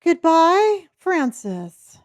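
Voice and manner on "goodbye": loud singsong goodbye. Real, not acted. Waving off a player.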